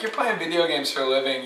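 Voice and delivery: ordinary talk, nothing marked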